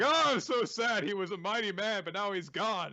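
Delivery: high-pitched, hammed-up mourning; mock lamenting. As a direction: wail it in fake grief. Faking mourning behind Tokiyuki.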